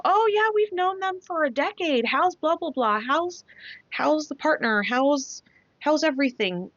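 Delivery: high, bubbly friendly greeting voice; chirpy shop staff. Retold or imitated. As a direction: play the overly chummy mechanic.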